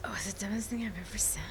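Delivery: fast breathy whisper aside. Acted. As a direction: hush it out quickly like a secret. Whispered aside before answering.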